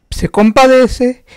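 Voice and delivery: high, slow, loud cry; preacher's fervent exclamation; exclaimed. Acted. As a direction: lift it up in wonder. Preacher exclaiming Jesus' mercy.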